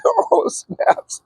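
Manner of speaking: whispered shock. Retold or imitated. Shocked at getting swats.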